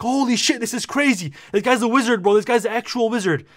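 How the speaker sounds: high-pitched, awestruck raving; freaking out. Retold or imitated. Kid telling all his siblings about the wizard doctor, freaking out. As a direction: re-enact giddy disbelief at full pitch. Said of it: "I'm, I'm freaking out".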